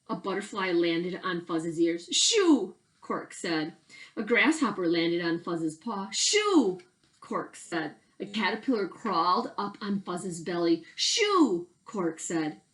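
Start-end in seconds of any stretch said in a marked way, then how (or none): 2.1-2.8 shoo. Acted. Shooing insects away. 6.1-6.8 high, loud shoo at the bug; high shooing call; sharp high shoo. Acted. Shooing insects away. 11.0-11.7 high, loud shoo at the bug; high shooing call; sharp high shoo. Acted. Shooing insects away.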